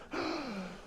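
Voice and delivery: big stagey gasp for air; theatrical gasp. Shown as a demonstration. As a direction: gasp in before the line.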